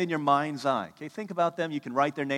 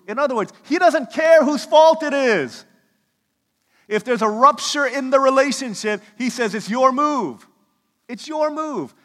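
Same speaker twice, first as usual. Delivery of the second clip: high, loud impassioned sermon delivery; forceful preaching; 11 semitones higher, 13 dB louder than usual. Real, not acted. Preacher tells church whose move it is, forceful preaching. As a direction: drive the point home at full force.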